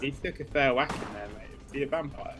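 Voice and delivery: ordinary delivery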